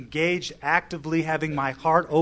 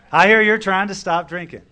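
louder, higher retold line; emphatic repeat; 4 semitones higher, 8 dB louder than usual.

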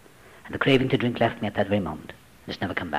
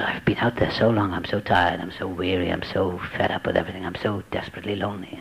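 weary sigh; 6 semitones lower, 4 dB quieter than usual. Retold or imitated. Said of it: "weary inner thoughts retold".

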